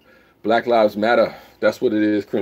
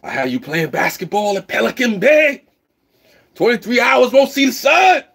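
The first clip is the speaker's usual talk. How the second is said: high, loud shouted movie-villain rant; hammed-up Training Day taunt; Training Day villain quote; 14 semitones higher, 6 dB louder than usual. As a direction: yell it like a crooked cop taunting. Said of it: "I just went training day on you".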